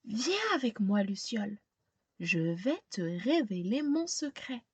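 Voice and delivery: put-on gentle read-aloud narration for a young child; storybook reading. A narrator's reading. Teacher reading a picture book to a child, storytelling voice. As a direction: tell it like a bedtime picture book.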